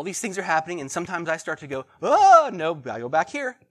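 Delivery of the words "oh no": sudden high yelp of alarm; a startled shout of oh no; panicked cry. Retold or imitated. Racer panics in sermon picture.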